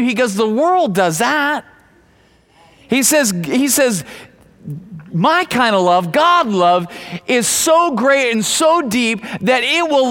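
rising preaching. Acted. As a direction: press the contrast home.

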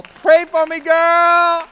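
put-on high, loud sassy girlfriend squeal. Pastor mimics a woman calling a girlfriend, high and loud. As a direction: squeal it like a gal pal. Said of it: "godly girlfriends in your life that you can just go".

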